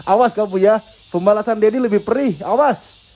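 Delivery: lightly hammed-up playful warning; playful mock threat. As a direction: pretend to threaten the baby, grinning.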